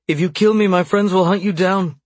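high, loud desperate warning; desperate threat. A narrator's reading. Bound captive threatening her torturer with her friends, desperate. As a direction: hurl a desperate warning, pitched right up. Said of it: "she said desperately".

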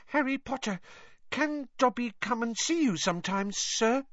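Dobby timidly asks Harry, high and soft; tentative house-elf. Acted. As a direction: ask shyly, not sure of the answer. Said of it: "Dobby asked tentatively".

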